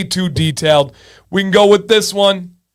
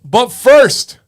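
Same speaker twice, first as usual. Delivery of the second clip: punched-up, louder and higher host call; showman announcing; 6 semitones higher, 5 dB louder than usual. Real, not acted. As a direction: announce it to the crowd. Announcing the game, show host voice.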